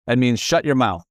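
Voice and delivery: usual speaking voice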